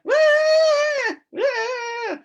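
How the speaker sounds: high, loud, panicky wail; frightened wail. Retold or imitated. Julie on an exposed ridge, high frightened wail. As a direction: mimic a scared climber's cry. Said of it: "I can hear her".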